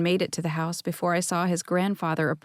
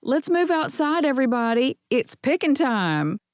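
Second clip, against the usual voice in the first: raised voice over the chatter, higher; mother lifting her voice over a busy kitchen; calling out; 6 semitones higher than usual. Acted. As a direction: call the crowd outside. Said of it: "Lifting her voice to be heard".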